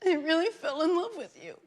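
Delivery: breaks into a laugh on the last words; laughing confession. Pretend. Host admitting he fell for America, while laughing. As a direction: laugh through the punchline of the confession.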